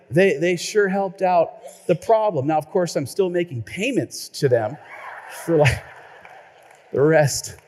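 normal talking voice

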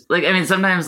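ordinary delivery

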